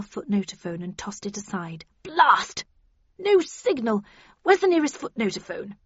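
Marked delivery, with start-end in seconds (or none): 2.0-5.8 raised pitch, cross and urgent; curse, then demand an answer; exasperated outburst. A narrator's reading. Frustrated Havisham finds phone dead.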